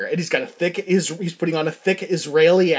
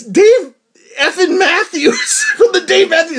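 laughing disbelief; 11 semitones higher, 4 dB louder than usual. Acted. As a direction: crack up at the realisation. Acted astonished recognition, cracking up.

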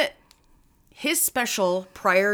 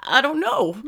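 high, fake-tearful whimper; badly faked sob on the words; phony crying; 7 semitones higher, 3 dB louder than usual. Guest asked for his room number, phony crying. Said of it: "phony baloney crying".

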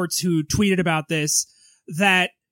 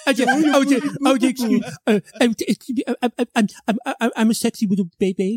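put-on Hugh Grant baby voice; faster than usual. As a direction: whine like a grown man playing baby. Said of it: "Hugh Grant do the baby voice".

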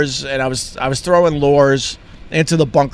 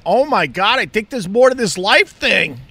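excited realization; 9 semitones higher than usual. Retold or imitated. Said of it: "I went".

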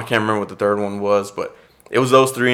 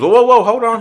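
high, loud, imitating an urgent interruption; 12 semitones higher, 5 dB louder than usual. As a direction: cut in as the caller stopping a goodbye. Caller interrupts his polite goodbye.

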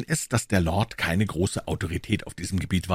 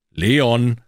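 LEON called louder and higher; insistent call; 4 semitones higher, 6 dB louder than usual. Acted. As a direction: call „Leon!“ loudly to get attention. Calls again after no response. („Leon!“ lauter, nachdrücklicher Ruf)

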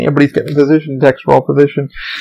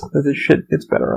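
ordinary delivery

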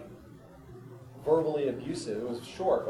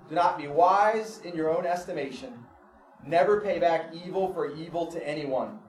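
ordinary talk

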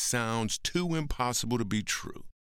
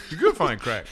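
laughing as he says it; laughing, louder; 7 semitones higher, 5 dB louder than usual. Real, not acted. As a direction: chuckle through the line.

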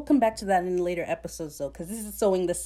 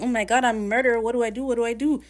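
mock panic; 4 semitones higher, 5 dB louder, faster than usual. Steed panicking after the killing.

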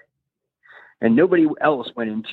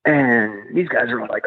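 ordinary talk, nothing marked

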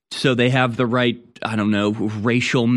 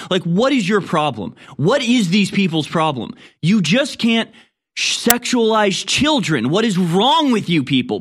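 rising indignant outburst, much higher; escalating comedy rant; 7 semitones higher than usual. Real, not acted. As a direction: build from exasperated to yelling. Rant peaks, pitch high.

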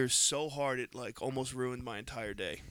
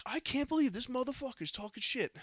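retold outrage; 11 semitones higher than usual. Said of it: "I'm like".

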